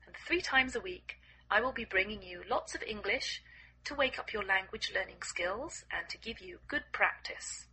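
ordinary delivery, nothing marked

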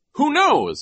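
very high, loud teasing question; silly, high-pitched jeer; Nero. A narrator's reading. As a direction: toss it out mockingly. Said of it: "he asked".

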